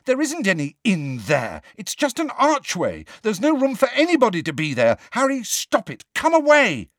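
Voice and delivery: high, loud scolding that builds; angry, agitated. A narrator's reading. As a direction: scold and order a friend away, voice climbing. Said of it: "sounding much angrier than".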